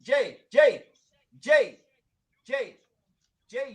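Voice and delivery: loud call to cut in. Real, not acted. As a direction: shout his name to get attention. Calling out to interrupt.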